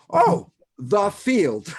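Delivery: slow showman's reveal; grand announcement. Real, not acted. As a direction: unveil the title like a prize. Announcing friend's book title.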